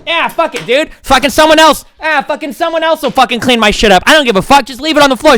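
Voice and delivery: dismissive slacker impression shrugging off the mess; bratty entitled kid. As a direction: sneer it like a spoiled kid who won't clean up. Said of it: "they're like".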